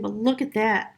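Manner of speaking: ordinary talk, nothing marked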